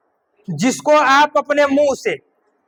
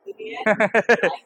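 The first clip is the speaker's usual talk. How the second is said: short low chuckle; 3 semitones lower, 5 dB quieter than usual. Real, not acted. Teacher joking with students about money, soft chuckle. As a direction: laugh softly to yourself.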